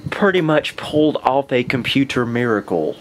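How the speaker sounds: ordinary talk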